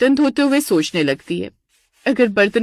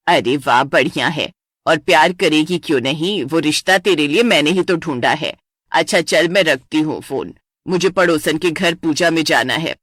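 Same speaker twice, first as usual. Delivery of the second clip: lower, offhand mother's voice; breezy mother; 5 semitones lower than usual. Acted. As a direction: brush her off and hang up.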